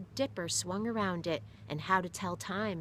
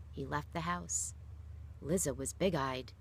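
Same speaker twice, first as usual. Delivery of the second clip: ordinary delivery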